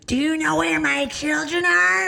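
high, loud mock screech; scowling goblin. Crazed woman demanding her children, loud goblin screech. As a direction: imitate her slowly in a shrieking goblin voice. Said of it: "like a scowling goblin".

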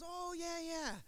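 high, recognising "oh yeah"; a lightly voiced older man who knows the name; imitating old man. Veteran recognising the church, high and lightly voiced. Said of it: "He goes".

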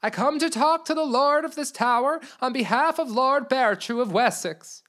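reedy, wheedling messenger voice; weaselly voice. Acted. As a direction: simper like a cunning herald. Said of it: "he said in a weaselly voice".

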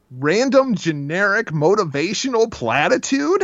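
platitude rising high in disbelief; incredulous repeat. Real, not acted. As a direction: echo it back in disbelief.

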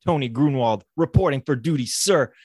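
loud, stiff soldier reporting for duty; stiff barking toy soldier. Pretend. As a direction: bark it like a rigid private. Mock soldier reporting to superior.

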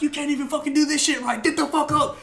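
high-pitched retold scolding; mock instructor berating. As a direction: lay into the recruit like an instructor. Retold instructors berating heavy recruit.

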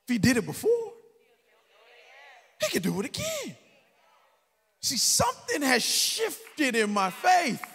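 high, loud preacher's cry; preaching shout. Acted. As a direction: shout it to the back pews. Preaching climax.

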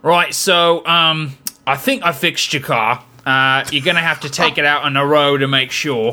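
mock Cockney mechanic; Cockney accent. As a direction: put on Cockney, hedging about the repair. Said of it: "or Cockney accent to say".

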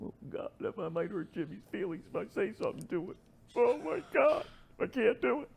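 hammed-up nervous kid afraid to speak up; mock timid youngster. Mocking timid young players.

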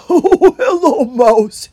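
big, loud, hearty character voice; welcoming hedgehog. A narrator's reading. Holgate greeting the mouse at the gate, loud welcome. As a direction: boom out a drawn greeting.

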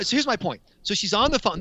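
normal talking voice

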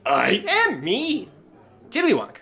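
high, slow parrot voice; bird mimic voice. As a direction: squawk it slowly like a parrot.

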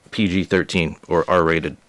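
normal talking voice

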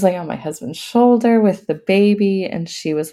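ordinary talk, nothing marked

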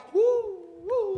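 high breathy whoops; quick high woos; excited woo. Real, not acted. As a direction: whoop with the spirit. Preacher exclaims mid-sermon.

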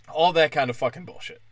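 ordinary delivery

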